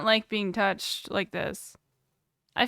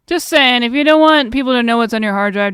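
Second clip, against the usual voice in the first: emphatic louder advice; 10 dB louder than usual. Real, not acted. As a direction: drive the point home loudly. Warning chat again.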